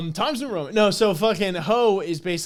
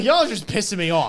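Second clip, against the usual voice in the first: loud, raised-pitch complaint; mock irritation; 6 semitones higher, 5 dB louder than usual. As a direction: play fed up with everyone. Mimics Quant fed up with team.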